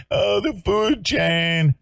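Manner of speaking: high, slow mocking whine; mocking sing-song. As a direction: drag it out like a sneering parody. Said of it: "He's probably shagging".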